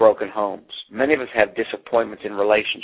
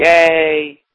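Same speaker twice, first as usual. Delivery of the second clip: mock crowd cheer; 7 semitones higher, 5 dB louder than usual. Crowd cheering the high-wire man.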